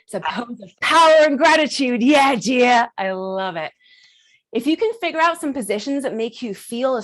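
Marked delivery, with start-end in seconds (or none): 0.8-2.9 loud, far-carrying hype; exuberant cheer. Shown as a demonstration. Host hyping a pose of power, exuberant. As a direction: cheer them on at full voice.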